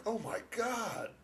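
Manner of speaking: high, soft mock gasp of horror; hushed, high-pitched alarm; imitated shock. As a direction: play the fooled hospital staff, aghast. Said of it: "they were like".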